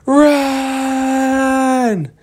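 high-pitched yelled warning; high shout. Acted. Lost Boys shouting to the friends to run, high frantic yell. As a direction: scream it out as a panicked kid.

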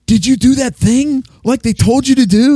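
high, loud-mouthed tourist chatter; obnoxious American tourist. Retold or imitated. Tourist couple chattering loudly up Mount Fuji, obnoxious. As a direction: play a grating American tourist. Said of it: "loud and obnoxious".

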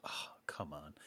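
a soft, breathy put-upon "oh come on"; mock exasperated sigh. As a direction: sigh it out like a fed-up waiter. Diner guy reacting to toast.

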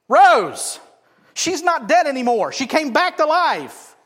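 high, shouted preaching; emphatic proclamation. Real, not acted. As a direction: proclaim the miracle at full volume. Preacher hammers home the miracle.

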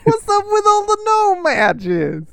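high, slow, hammed-up New York whine; terrible New York accent. Host's over-the-top New York impression, mocked by co-host. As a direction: overdo the New York complaint. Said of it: "that was terrible".

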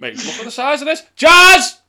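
high panicked yell; screaming at a spider. Real, not acted. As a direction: shriek for help at a spider. Spider approaching, calls wife.

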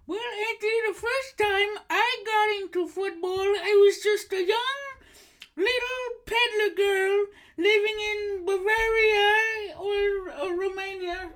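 very high, loud, gushing granny voice; a shrieking delighted old woman; high old lady voice. Acted. Host in falsetto as psychic, gushing shrill greeting. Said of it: "doing the voice".

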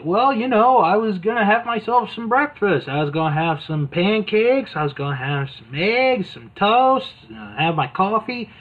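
a hint of a laid-back announcer; Mean Gene. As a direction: shrug it off like breakfast is all that matters. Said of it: "Mean Gene's like".

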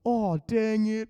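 high, slow, loud mock groan; imitated exasperation. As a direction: play a kid whose tower just fell. Retold kid's cups fall down.

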